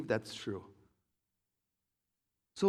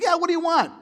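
high, loud offer; dad calling out; 14 semitones higher, 10 dB louder than usual. Retold or imitated. Dad offering the kids a treat, loud and high. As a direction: sing it out like a generous dad. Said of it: "He's like".

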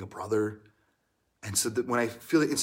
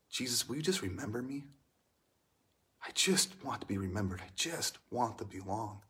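pleading; 3 semitones lower, 9 dB quieter than usual. Acted. Dying thief pleads to Jesus.

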